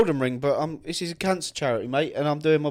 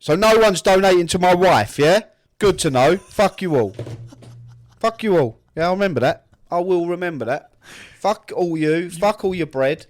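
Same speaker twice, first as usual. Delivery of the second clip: higher and much louder, hammed-up outrage; mock indignant rant; 5 semitones higher, 9 dB louder than usual. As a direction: play the betrayed husband, loudly. Mock sulk about no donations.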